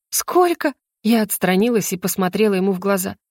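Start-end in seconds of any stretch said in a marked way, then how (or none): 0.1-0.7 high-pitched startled question; astonished question. Acted. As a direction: pitch it up in disbelief. Told she slept three days. (изумлённо переспрашивает)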